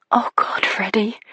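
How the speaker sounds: whispered, breathless plea; voiceless whisper, dying breath; barely air. Acted. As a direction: gasp his name out with no voice. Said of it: "My words are barely air".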